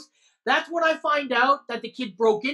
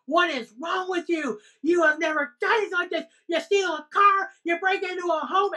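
higher and fast, a furious mother scolding; retold rant, pitched up while pounding the table; angry mom yelling; 6 semitones higher than usual. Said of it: "My mom just starts fucking hammering on the desk".

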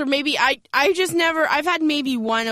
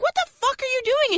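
shocked; 11 semitones higher than usual. Retold or imitated. Catching boyfriend peeing.

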